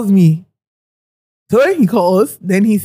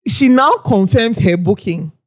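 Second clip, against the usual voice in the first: ordinary delivery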